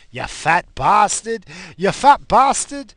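put-on loud, high, slow Portuguese-accented insult; Portuguese grandfather. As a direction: bark it like an old Portuguese grandpa. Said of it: "My grandfather had a broken accent".

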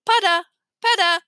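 put-on high babbling baby; baby talk. A narrator's reading. Tootsie babbling to Peter, pulling herself up. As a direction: say it like a baby learning a name. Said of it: "Tootsie said".

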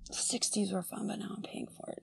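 soft whisper, testing the line; whispering to herself. Real, not acted. Contestant whispers the movie quote to herself to place it, in a whisper.

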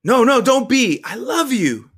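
high, loud, pleading comfort; impassioned loving plea; urgent reassurance. A narrator's reading. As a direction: rush to reassure a scared child. Urgently reassuring the scared child.

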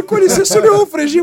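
fast, high and loud; rushing out an excited question; eager question. Retold or imitated. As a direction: suspect excitedly asking his name. Suspect recognizing him.

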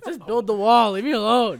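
mock defensive whine. Joking defensive outburst.

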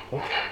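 breathy sigh through a mask; heavy mask sigh. Pretend. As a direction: sigh out under the hot mask. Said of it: "It's getting hot in this mask".

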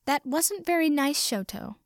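high, tiny fairy scolding. A narrator's reading. Tiny Mina scolding the ghost in a high little voice. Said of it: "Mina scolded in a tiny voice".

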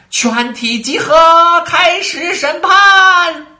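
high, loud and slow, called from far off; distant shout. A narrator's reading. Herald summoning everyone to the trial, slow loud shout. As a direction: call everyone to court from a distance. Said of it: "远处一个声音大喊".

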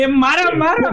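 shouting. Retold or imitated. Friend yelling hit him.

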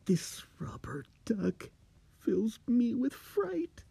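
terrified whisper. Acted. Steve confesses his terror.

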